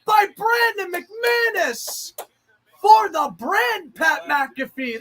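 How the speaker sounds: slow, high, loud hype on the tackle; shouted homer call for McAfee; excited shout. Real, not acted. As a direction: sell the big hit to the crowd.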